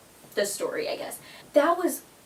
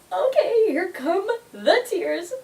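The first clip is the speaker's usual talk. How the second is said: mock teary; 9 semitones higher, 4 dB louder than usual. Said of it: "And I'm like".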